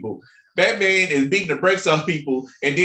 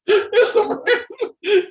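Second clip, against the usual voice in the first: laughing; slower than usual. Real, not acted. Laughing fit.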